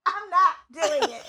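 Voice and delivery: giggling refusal; laughing. Real, not acted. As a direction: laugh out the words. Still laughing at the memory.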